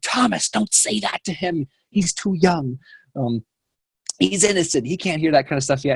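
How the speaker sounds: high, mock-stern grandma scolding; a lightly voiced grandmother telling him off; grandma scolding grandpa for what he said in front of the boy. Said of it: "turns around".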